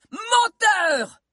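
shouted accusation. Acted. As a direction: scream it at him. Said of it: "cria soudain Mordelia".

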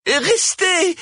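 very high, pleading cry; urgent retort. A narrator's reading. Cosima countering the mayor to the crowd, crying out. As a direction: cry out to hold them back. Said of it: "répliqua aussitôt Cosima".

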